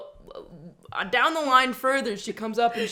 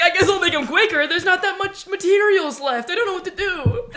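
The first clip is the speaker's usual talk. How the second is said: high, loud retold whine, near laughing; flustered protest; 8 semitones higher, 6 dB louder than usual. New hire answering an angry coworker, mock flustered and high. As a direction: plead helplessly, pitched up and louder.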